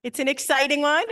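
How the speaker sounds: normal talking voice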